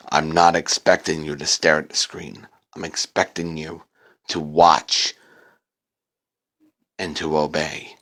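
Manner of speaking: low, quiet, looming voice; sinister low command; menacing dark voice. A narrator's reading. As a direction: speak down to him like a threat. Dark voice answers the narrator.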